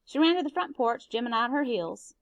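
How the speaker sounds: plain reading voice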